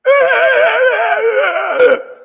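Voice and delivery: high, loud cackle bursting out; helpless screaming laughter; loud shrieking laugh. Real, not acted. Keeps laughing at the clip.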